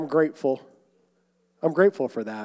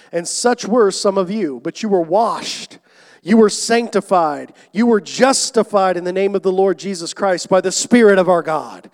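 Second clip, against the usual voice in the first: louder, emphatic preaching; preaching at full force; 3 semitones higher, 7 dB louder than usual. Acted. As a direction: drive each phrase home from the pulpit. Scripture climax, preaching.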